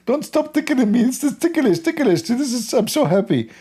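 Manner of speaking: squealing with delight, very high; giggly ecstatic high voice; giddy high voice. Pretend. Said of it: "like Allah, like".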